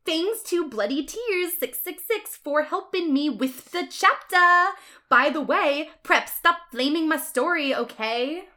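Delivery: high, loud fangirl aside; author's note voice. Acted. The author thanks a helper and scolds flamers, high-voiced. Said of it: "Author's note".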